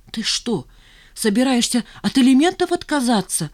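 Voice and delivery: be thrown by the news; bewildered mother. Acted. Mother confused by Nina refusing support, bewildered. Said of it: "растерялась Раиса Романовна".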